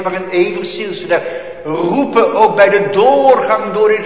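loud, driving sermon cry; fervent preaching. Acted. Preacher calling his people to pray, loud fervent. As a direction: preach with full force.

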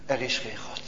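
a quiet heavy echo of the doubt; hushed, falling. Acted. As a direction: let the words sink to near silence. Preacher repeats doubt, hushed.

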